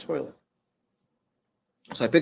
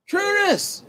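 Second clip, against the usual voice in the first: thin, high, weak call of a name; a sick father calling out feebly; faint pitched-up cry of a name; 4 dB louder than usual. Retold or imitated. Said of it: "voice was weak".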